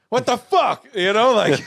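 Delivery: high, loud exasperated burst; exasperated yell. Retold or imitated. Opening the door for his girlfriend, a loud exasperated outburst. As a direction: throw it at her as the door opens.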